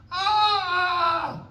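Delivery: high, sustained imitated wail; mock baby scream. Dad imitating his baby screaming, mock wail. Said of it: "she starts screaming".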